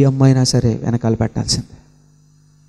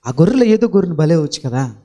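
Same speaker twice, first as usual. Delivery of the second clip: normal talking voice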